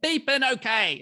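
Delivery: shouted high and loud at his dad; furious shout of a grieving son; retold angry yell. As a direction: yell it out through grief. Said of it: "And I swore at him".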